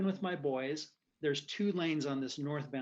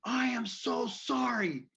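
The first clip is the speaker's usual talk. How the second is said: high, drawn-out earnest shout; yelled apology; 8 semitones higher, 4 dB louder, slower than usual. Retold or imitated. Driver apologizing to the man he cut off, yelling. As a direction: shout the apology slowly, imitating a heated row. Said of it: "I start yelling at him".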